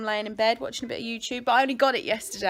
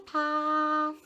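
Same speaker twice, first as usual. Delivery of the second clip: high, sustained singsong creature call; Jigglypuff; 10 semitones higher, slower than usual. Pretend. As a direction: chant it in a high voice, drawing out each vowel. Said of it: "I can do Jigglypuff".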